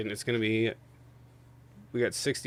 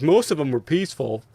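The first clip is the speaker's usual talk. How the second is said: mocking; 4 semitones higher, 8 dB louder than usual. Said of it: "You're like".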